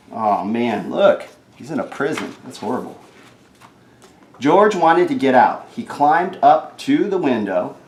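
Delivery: ordinary delivery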